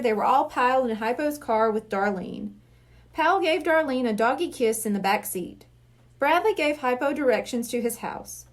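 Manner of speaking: ordinary delivery, nothing marked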